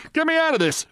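high-pitched panicked bawl; acted high yell for help. A narrator's reading. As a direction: bawl it as if trapped. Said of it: "I bawled".

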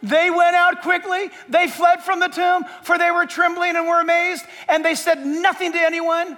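loud, high proclaiming of the verse; preacher booming the Bible verse; shouted scripture. Acted. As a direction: thunder the text out to the back row.

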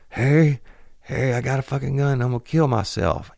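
put-on high, loud, desperate drunk wail; drunk suicidal man. Drunk man with a gun yelling to Harry at the door, high desperate wail. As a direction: cry it out like a drunk at the end of his rope.

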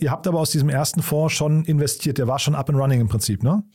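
ordinary talk, nothing marked

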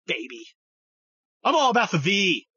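ordinary talk, nothing marked

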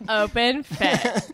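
drawn-out, laughing, mock-grand brand name; slow mock announcer. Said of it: "I'm like".

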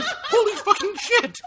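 slow, high, loud cry of disbelief; laughing, high-pitched retold shock; shocked exclamation. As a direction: stretch each word out in hammed-up astonishment. Retold shock at discovering the truth.

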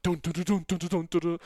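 mock Samoan drums pounding in the pool tunnel; imitated drum beats. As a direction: beat out the drums with your voice. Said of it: "like Samoan drums".

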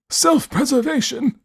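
put-on high, slow, scornful old man echo; incredulous old man. A narrator's reading. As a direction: repeat the word in cold disbelief. Host repeats, incredulous.

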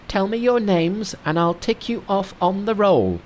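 high, bright-pitched monkey king. Acted. Narrator voicing Monkey to his master, high monkey voice. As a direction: play the cocky monkey hero up high.

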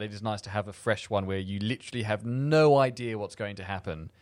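normal talking voice

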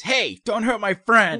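high, loud mimicked yell; Leslie. Creature echoing the player's own warning, high mimicking shout. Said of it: "is your own voice".

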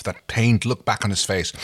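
ordinary delivery

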